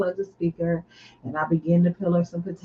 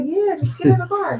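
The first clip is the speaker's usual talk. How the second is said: pitched way up, pleading and exasperated; exasperated insistence; 11 semitones higher than usual. Retold or imitated. Wife retelling how she urged Kelly into the car, high and exasperated. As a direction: get her in the car already, fed up.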